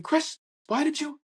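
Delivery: very high, exclaimed questioning; Barry. Retold or imitated. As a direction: call out in a high, put-on voice. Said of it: "Barry comes out going".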